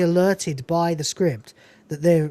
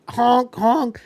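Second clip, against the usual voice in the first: mock honking; 5 semitones higher than usual. Imitating swans.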